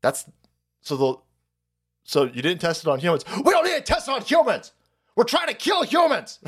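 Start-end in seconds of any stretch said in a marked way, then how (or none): 3.4-6.4 high, fast mock shout; mock villain shout. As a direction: yell it like a cartoon villain confessing. Mocking the establishment's reply.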